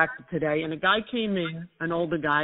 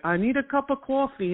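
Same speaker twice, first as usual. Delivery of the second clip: higher-pitched imitation Brooklyn accent; 7 semitones higher than usual. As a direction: mimic a thick old-school New York accent. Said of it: "He was talking like".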